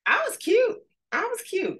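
very high, sassy squeal; sassy brag. Real, not acted. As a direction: show off playfully. Playful brag.

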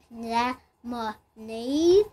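Lemonade said slowly as lem-on-ade; teacher sounding out lem-on-ade; syllable by syllable. Shown as a demonstration. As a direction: tap out lem-on-ade beat by beat. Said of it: "also three syllables".

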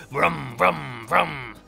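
low chainsaw vrooms, drawn out; imitated chainsaw revving. Leatherface's familiar sound is heard, low chainsaw revving. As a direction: rev it like an engine in three bursts. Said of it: "we hear that familiar sound".

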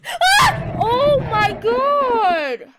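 high shriek into a long, drawn-out yell; very loud, slow, wailing shout; terrified scream. Real, not acted. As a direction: scream and stretch the words out in horror. Screaming at a jump scare.

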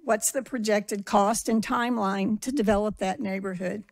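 ordinary delivery

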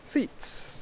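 ordinary talk

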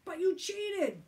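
high, loud protest; shouting. A narrator's reading. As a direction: shout the accusation indignantly. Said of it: "shouted Tom".

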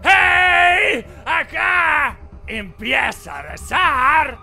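high, loud menacing yell; angry shout. Acted. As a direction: threaten him at the top of your lungs. Villager threatening the intruder.

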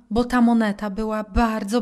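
normal talking voice

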